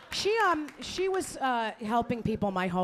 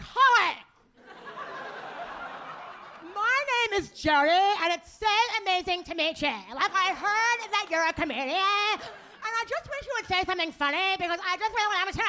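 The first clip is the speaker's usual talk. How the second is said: hammed-up high, distorted socialite voice; mock her bizarre speech, high and smeared into gibberish; weird-talking girlfriend; 9 semitones higher than usual. Friend's girlfriend greeting a comedian, exaggerated weird high voice. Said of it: "literally she talked like this".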